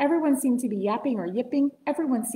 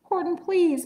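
higher, pleading little mayor voice; 8 semitones higher than usual. A narrator's reading. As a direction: beg Horton for help. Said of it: "pleaded the voice of the mayor".

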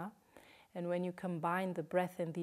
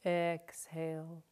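guided breathing cues. Shown as a demonstration. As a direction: lead the breath gently, leaving room to breathe. Counts breaths for the class.

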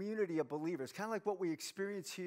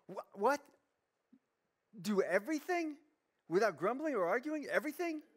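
higher, questioning incredulity; mock disbelief; 5 semitones higher than usual. Preacher balking at the no-grumbling rule, incredulous. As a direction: balk at it, rising to a high last word.

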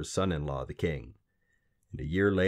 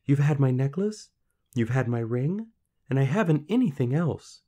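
put-on pleading girl; 7 semitones higher, 4 dB louder than usual. Girl pleads with little man.

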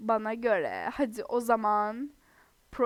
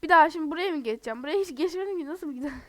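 usual speaking voice

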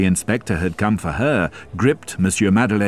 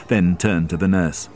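usual speaking voice

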